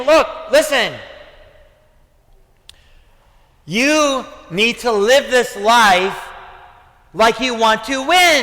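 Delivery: high, loud preaching; impassioned, insistent preacher's charge; forceful exhortation. Retold or imitated. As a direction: urge the congregation at full force. Preacher voices Paul's exhortation forcefully.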